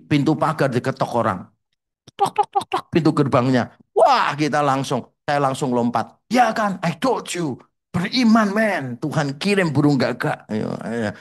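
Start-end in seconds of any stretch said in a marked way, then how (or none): 2.1-2.6 high voiced tok tok tok; young man at the gate, voicing the knock high; knocking sound imitated. 6.3-10.5 smug told-you-so. Retold or imitated. Gloating to friends, faith proven.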